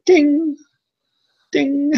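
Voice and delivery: high sung ding ding like a blinker; mock turn signal ding. Imitating a car blinker to friends, high ding ding.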